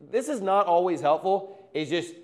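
ordinary talk, nothing marked

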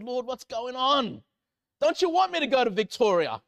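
frustrated. Retold or imitated. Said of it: "I'm like, frustrated".

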